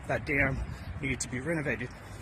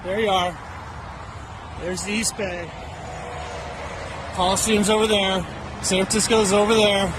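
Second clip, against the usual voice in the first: excited; 4 semitones higher, 5 dB louder than usual. Real, not acted. Pointing out the view outdoors.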